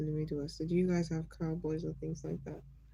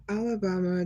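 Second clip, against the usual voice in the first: normal talking voice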